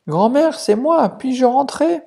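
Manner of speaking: put-on a polite child at the door; little girl. (la fillette à la porte, voix aiguë)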